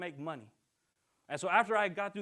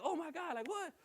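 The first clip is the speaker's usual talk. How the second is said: high shocked gasp of surprise; mock shocked cashier, pitched high; 11 semitones higher, 4 dB quieter than usual. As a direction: play the cashier stunned. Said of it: "the lady's shocked".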